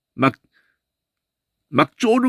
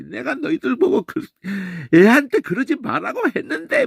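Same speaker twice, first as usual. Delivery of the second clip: much higher, pleading reproach; Reuben reproaching; 11 semitones higher than usual. Retold or imitated. Reuben reproaching the brothers in front of Joseph, high-pitched. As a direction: whine it like an upset older brother. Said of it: "막 이러면서".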